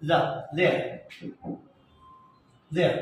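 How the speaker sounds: short separate "the, there, there", fricative th; proper voiced th. Shown as a demonstration. Teacher modelling sound missing in Bangla, voiced th. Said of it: "এটা হবে হচ্ছে".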